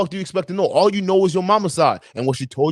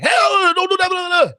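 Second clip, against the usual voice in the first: sudden sharp yell, much higher; raised voice; 13 semitones higher, faster than usual. Retold or imitated. Father yelling at his wandering daughter, raised voice. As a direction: snap at a toddler on instinct. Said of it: "why did I just raise my voice at a child".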